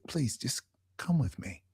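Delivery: soft, half-whispered retold request; hushed plea. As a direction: urge softly, almost in a whisper. Retold stranger gently urging her along.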